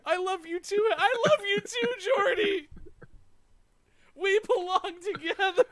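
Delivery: high, loud squealing fan with laughing sobs; swooning fan. Retold or imitated. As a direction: gush like a crying teen fan. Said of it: "There's all these caps going".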